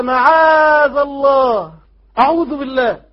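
loud, high, drawn-out cry; emphatic refusal. Acted. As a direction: shout out seeking refuge, stretching the words. Cries out Joseph's refusal in recitation. (صيحة استعاذة عالية ممدودة)

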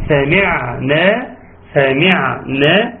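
slow recited سمعنا with a sustained ع; measured ayn. Shown as a demonstration. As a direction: give the silent ع in سمعنا its middle length. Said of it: "ناخد بالنا من العين الساكنة". (سمعنا بتمهل وتوسط في العين)

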